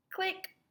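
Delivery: click. Pretend. Camera click sound.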